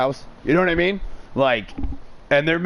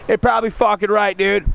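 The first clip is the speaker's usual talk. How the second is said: higher, louder buddy agreeing; excited agreement; 7 semitones higher, 3 dB louder than usual. Retold or imitated. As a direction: egg him on, pumped up. Roommate agreeing loudly.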